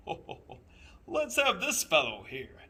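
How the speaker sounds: booming jolly Santa Claus. A narrator's reading. Santa calling for the boy from his sleigh, jolly and loud. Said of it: "and said".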